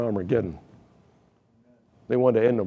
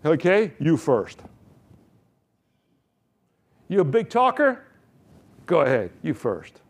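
mocking challenge; 3 semitones higher than usual. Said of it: "And that was the attitude".

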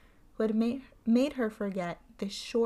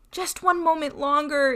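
pleading; 6 semitones higher, 7 dB louder than usual. A narrator's reading. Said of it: "entreated his wife".

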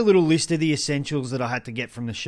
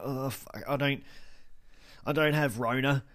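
soft flustered mutter; embarrassed mumble; 6 dB quieter than usual. Retold or imitated. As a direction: mutter it under your breath, embarrassed. Embarrassed aside to cashier.

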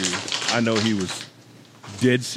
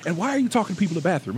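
imitated nagging wife; 7 semitones higher, faster than usual. Wife confronts him about bathroom chats, acted nagging. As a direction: imitate a wife questioning a chatty habit.

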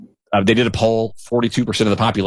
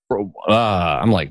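usual speaking voice

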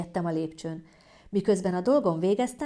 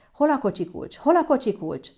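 high, raised, frantic questioning; frantic panic; 7 semitones higher, 5 dB louder than usual. Acted. Partner hunting car keys for labour dash, frantic. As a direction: search in a comic panic.

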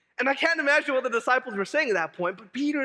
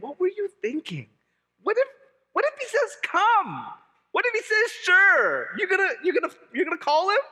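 much higher, disbelieving questions; incredulous; 10 semitones higher, 4 dB louder than usual. Retold or imitated. Pastor imagining his doubts about Peter: high, incredulous questions. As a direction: squeak out the disbelief, louder and higher. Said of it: "I think".

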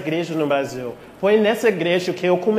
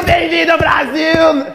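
loud welcome shout; 8 semitones higher, 5 dB louder than usual. Retold or imitated. Acts out Rômulo's loud welcome.

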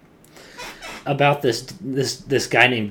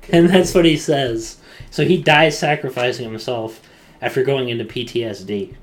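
usual speaking voice

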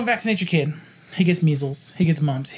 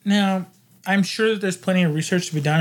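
ordinary delivery

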